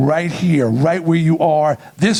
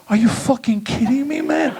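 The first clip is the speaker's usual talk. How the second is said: disbelief; 7 semitones higher than usual. Retold or imitated. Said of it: "I'm like".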